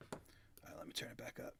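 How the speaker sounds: quick unvoiced whisper. Real, not acted. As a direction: whisper it to yourself. Whispers while adjusting volume.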